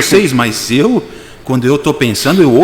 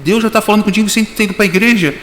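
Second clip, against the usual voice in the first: Vera surpresa; 7 semitones higher than usual. Retold or imitated. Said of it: "Ela falou".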